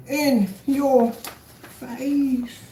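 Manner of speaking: extremely slow, high singsong taunt; word-by-word, long-held crowing; drawn-out gloat. Real, not acted. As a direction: stretch every word to rub it in. Repeats the gloat, stretched right out.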